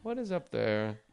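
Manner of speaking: slow, hushed Dateline narrator; Keith Morrison. Acted. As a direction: draw out the mystery, dropping to a near whisper. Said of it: "read it all as Keith Morrison from Dateline".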